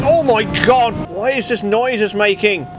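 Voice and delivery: real disbelief at the noise; shocked outcry. Real, not acted. Engine makes a terrible noise.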